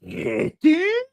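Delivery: very high rising Kitten?; high baby-talk call; pet call. Retold or imitated. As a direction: squeak it up like calling a cat. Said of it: "We, we go".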